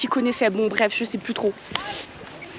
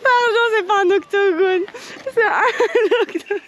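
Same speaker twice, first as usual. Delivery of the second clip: high voice breaking into laughter; real fit of laughter; laughing; 9 semitones higher, 4 dB louder than usual. Real, not acted. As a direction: try to get the words out through giggles. Cracks up over octogone slip.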